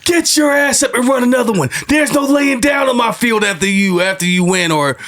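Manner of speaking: high, loud drill-coach yell; screaming coach. Retold or imitated. Coach yelling at a player lying on the field, screaming. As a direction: shout orders at full pitch, imitating an irate coach. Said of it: "my coach was screaming".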